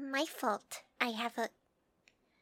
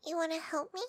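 normal talking voice

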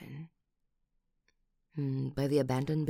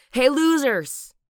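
loud high slow jeering yell; mocking loud callout; taunting shout; 11 semitones higher, 14 dB louder, slower than usual. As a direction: call the thugs out across the street. Confronts attackers.

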